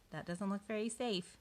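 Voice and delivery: ordinary delivery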